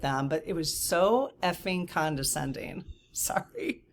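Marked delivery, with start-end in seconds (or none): 1.4-3.8 soft unvoiced whisper; whispered aside. Real, not acted. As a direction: drop to a whisper for the swear. Whispered swear and apology.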